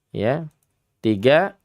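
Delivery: usual speaking voice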